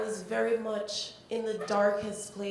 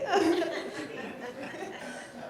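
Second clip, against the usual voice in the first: a short high laugh; 7 semitones higher than usual. Real, not acted. Finishing the joke to the audience, a short high laugh. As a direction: chuckle at the memory.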